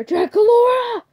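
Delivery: high, loud startled yell of the name; startled shout. Acted. Father startled by the sleeping bag, shrieking his daughter's name high and loud. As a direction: jump and yell her name in shock.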